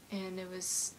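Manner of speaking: ordinary talk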